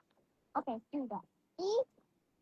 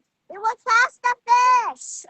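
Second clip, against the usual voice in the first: loud slow insistence; 5 semitones higher, 11 dB louder, slower than usual. Real, not acted. Child repeats line louder.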